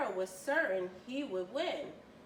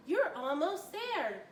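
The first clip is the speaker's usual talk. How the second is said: a raised, hopeful cheer; crowd cheering; 6 semitones higher than usual. A narrator's reading. The crowd calls to Tom near the line, cheering. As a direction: spur him over the line. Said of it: "the crowd cheered".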